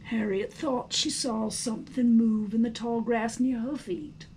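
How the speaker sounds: ordinary delivery, nothing marked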